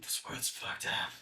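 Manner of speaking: hushed, unvoiced growl of a caped vigilante; Batman. Friend doing Batman's grim verdict on the world, hushed. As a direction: say it very low-volume, almost all breath. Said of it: "Batman says, he's like".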